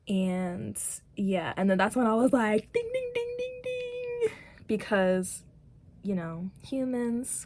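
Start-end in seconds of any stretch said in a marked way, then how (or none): 2.7-4.3 very high sung-out bell imitation; bell ding. Real, not acted. Speaker realizes the lesson, chiming a high bell sound. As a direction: chime like a correct-answer bell. Speaker reacting, idea clicking.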